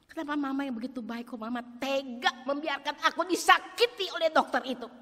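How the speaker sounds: put-on high, loud hurt child voice; child's reproach. Child asks why kind mama let the doctor hurt her, loud. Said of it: "bagi anak itu tidak mengerti".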